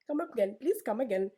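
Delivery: ordinary talk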